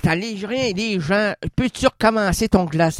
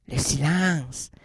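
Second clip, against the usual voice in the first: seance medium; 7 semitones lower, 5 dB quieter, slower than usual. Acted. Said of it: "le médium qui rentre en transe".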